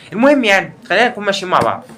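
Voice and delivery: normal talking voice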